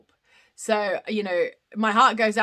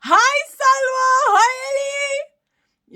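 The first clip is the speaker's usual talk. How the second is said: high, loud, stretched-out calls of hello; excited greeting; 14 semitones higher, 7 dB louder, much slower than usual. Real, not acted. Welcoming Salwa and Ellie into the live, excited drawn-out hello.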